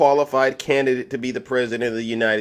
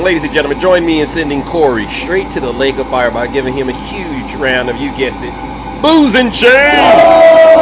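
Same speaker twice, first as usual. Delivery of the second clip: rally the audience like a game show; mock game-show host; 6 dB louder than usual. Announces to live audience.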